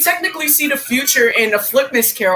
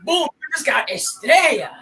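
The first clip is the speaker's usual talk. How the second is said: showy character voice, pitched up; Ernesto; 4 semitones higher than usual. Shown as a demonstration. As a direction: put on a higher, theatrical catchphrase voice. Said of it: "I can do the Ernesto voice".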